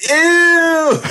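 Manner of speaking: shrill high laughing shout of disgust; grossed-out ew. Retold or imitated. Son teasing his dad with a high, laughing shout of disgust. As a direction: squeal it like a kid hearing something icky. Kids react to dad coming out.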